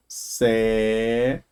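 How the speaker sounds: secreta sounded out very slowly, syllable by syllable; slow syllable split. Shown as a demonstration. Teacher dividing the word for students, very slowly. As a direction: draw out each syllable of secreta. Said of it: "lá no finalzinho".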